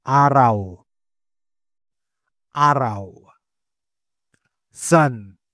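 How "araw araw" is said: "Araw" with a clear open ah; model word. Shown as a demonstration. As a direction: say "Araw" for learners. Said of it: "Another example". (malinaw na "Araw")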